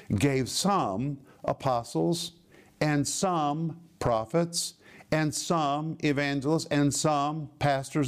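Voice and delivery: slow, deliberate stress on each "some"; categorical, weighty punch on every "some"; emphatic "some". Shown as a demonstration. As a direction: draw out "some" every time, then land the noun. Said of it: "as an emphatic, categorical statement".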